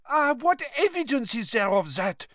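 very high, exasperated character voice; Madame Maxime. A narrator's reading. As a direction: throw it out high, in disbelief. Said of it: "said Madame Maxime, throwing up her huge hands".

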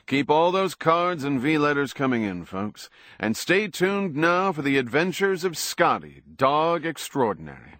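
smooth TV host. A narrator's reading. Star signs off his TV show, smooth announcer voice. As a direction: sell it like a variety show host. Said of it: "Jason said smoothly".

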